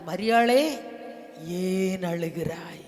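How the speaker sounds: slow, low questioning of a weeping woman; Jesus. Retold or imitated. Jesus speaks to weeping Mary, slow and solemn. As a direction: address her, then ask slowly and low. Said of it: "மரியாளை பார்த்தவர் சொன்னார்".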